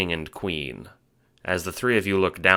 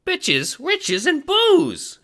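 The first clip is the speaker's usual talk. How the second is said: very high, slow squeaky echo; squeaky henchman; 5 dB louder, much slower than usual. Acted. Little henchman gleefully echoing his boss's dream. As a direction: parrot the boss in a giddy squeak. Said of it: "Ace repeats".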